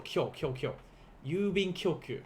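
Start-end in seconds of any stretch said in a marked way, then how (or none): 0.0-0.7 quick, soft repeats of "kyo", one beat each; softer "kyo, kyo, kyo", each unbroken; kyo as one syllable. Shown as a demonstration. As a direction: drill "kyo" as a single glide. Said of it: "That is one syllable".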